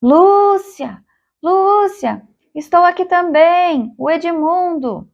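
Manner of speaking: high, drawn-out call; high-pitched shout into the distance. A narrator's reading. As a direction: call out slowly to someone out of sight. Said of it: "Começou a gritar".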